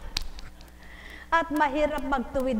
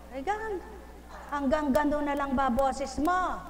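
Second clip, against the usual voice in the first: ordinary talk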